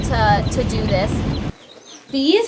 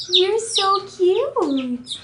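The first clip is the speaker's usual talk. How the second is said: very slow, higher cooing; 7 semitones higher, much slower than usual. Real, not acted. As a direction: stretch out "You're so cute" slowly and higher, cooing at the chick. Cooing at a chick, repeated.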